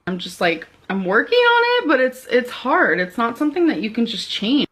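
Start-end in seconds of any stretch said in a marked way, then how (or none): 0.9-3.0 much higher, sing-song and bright. Real, not acted. Vlogger brushing off depression in a high sing-song. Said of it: "that sing-song voice".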